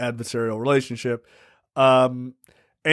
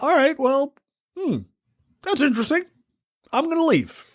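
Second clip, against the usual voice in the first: much higher-pitched imitation; lifted, high-pitched mock politeness; mock brush-off; 10 semitones higher than usual. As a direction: put on a high voice to excuse yourself. Acting out the guy's brush-off.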